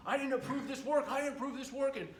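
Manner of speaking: high, loud, panicked protest; freaking out. Retold or imitated. Lawyer calling about the bill, imitated freaking out. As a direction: imitate a frantic customer denying approval. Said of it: "she's freaking out".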